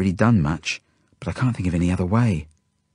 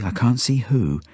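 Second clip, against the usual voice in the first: ordinary delivery, nothing marked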